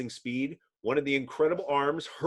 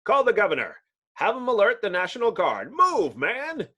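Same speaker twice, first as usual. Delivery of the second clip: barked order; 5 semitones higher, 5 dB louder than usual. Acted. Mayor issues an emergency order.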